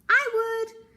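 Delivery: high, loud creaking voice; creaky coral. A narrator's reading. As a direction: creak out the answer like old coral. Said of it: "creaked a crusty coral".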